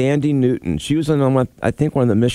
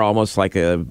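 ordinary delivery

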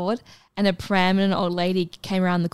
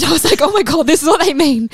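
higher and louder, rushed with glee; excited squeal; 9 semitones higher, 9 dB louder, faster than usual. Retold or imitated. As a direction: squeal it like a sudden revelation. Excited realisation retold with a squeal.

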